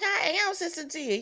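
high-pitched retold objection; mock protest. Mother objecting to her son's remark, mock protest.

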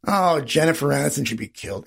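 low, offhand mock grumble; throwaway line. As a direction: toss it off as no big deal. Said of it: "This throwaway line".

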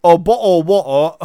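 "Oh, bo-oh, wo-oh" loud and laughing, lips pursed; loud mock British. Shown as a demonstration. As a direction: shout the British water bottle. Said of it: "sounds like you're underwater".

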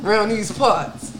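high, loud laughing brag; excited laughing. Real, not acted. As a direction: laugh it out, thrilled. Excited joking bragging.

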